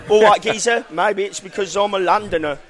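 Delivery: confident Cockney London demo; London accent. Shown as a demonstration. Pub owner demonstrating it for host, London accent. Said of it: "teach you the London accent".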